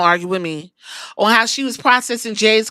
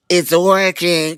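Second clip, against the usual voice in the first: sinister slow; 4 semitones lower, slower than usual. Retold or imitated. Villain delighted plan succeeds.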